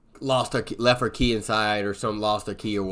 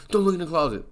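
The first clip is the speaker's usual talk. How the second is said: frightened plea; 8 semitones higher, faster than usual. Retold or imitated. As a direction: warn the cop in a panic. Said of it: "Allie's like".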